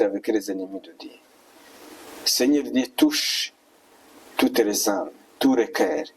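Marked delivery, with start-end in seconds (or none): none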